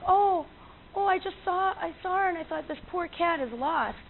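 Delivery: high-pitched, stammered excuse; imitated flustered lady. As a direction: act out a well-meaning woman caught out.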